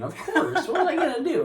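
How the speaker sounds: high, loud, laughing protest; laughing self-defence. Real, not acted. Defending why he ran away, laughing protest. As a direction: protest loudly through laughter.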